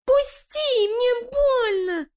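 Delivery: very high, slow, pained squeal; a small creature begging to be let go; squealing rat. Acted. Крыса в лапах Лилии. (писк крысы от боли)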